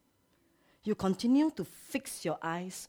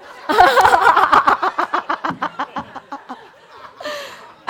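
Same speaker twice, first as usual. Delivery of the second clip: loud delighted laugh; 11 semitones higher, 16 dB louder than usual. Real, not acted.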